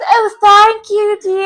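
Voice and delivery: loud, drawn-out hammed-up British; over-the-top British gushing; mock British accent. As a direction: play at being British, high and slow. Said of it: "pretended to have a British accent".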